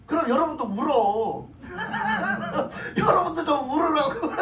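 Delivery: teasing, through laughter; laughing. Real, not acted. Preacher jokingly tells congregation to cry too, laughing. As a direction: urge them on, breaking into laughs.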